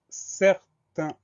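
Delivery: "certain" drawn out in two separate syllables; slow dictation. Shown as a demonstration. Dictates new word syllable by syllable.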